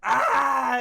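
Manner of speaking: loud, high raw scream of pain; agonized scream. Acted. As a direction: scream like a dying animal. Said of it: "he screams in agony".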